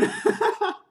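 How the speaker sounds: high, sudden burst of laughter. Real, not acted. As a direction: laugh out at the pun. Laughing at punchline.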